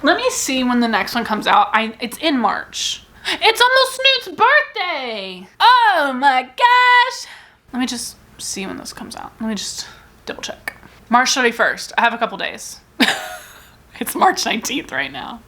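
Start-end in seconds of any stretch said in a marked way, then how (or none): 3.3-7.3 much higher, slow, gushing squeal; excited pet talk. Real, not acted. Spotting her pet Snoot, a high excited gush. 7.7-10.8 soft unvoiced checking under the breath; whisper to herself. Real, not acted. Checking a release date, a hushed whisper.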